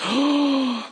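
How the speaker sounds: a high, startled "oh" as she catches her breath; surprised gasp. Retold or imitated. Wife hearing the prophecy, a high startled oh. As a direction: let the word jump up in sudden delight. Wife reacts to the horse-farm word.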